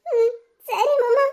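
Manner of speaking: slow, tearful little yes through sobs; crying softly. Acted. Wife tearfully agrees to make up with her husband, slow and crying. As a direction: agree while still weeping. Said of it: "இப்ப வச்சு நீ அழுவாம இருக்கலாம்ல".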